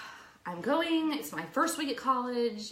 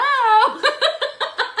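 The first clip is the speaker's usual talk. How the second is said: high burst of laughter; loud laugh; 14 dB louder than usual. Real, not acted. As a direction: crack up at the story. Laughing at own story.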